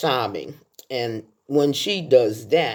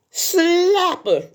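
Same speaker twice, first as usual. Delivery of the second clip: shrill high cry, much higher; urging shout; 4 dB louder than usual. Retold or imitated. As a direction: yell it out high to egg her on. Quoting a film's funeral scene.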